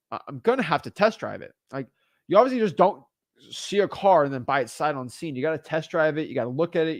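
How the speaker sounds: ordinary talk, nothing marked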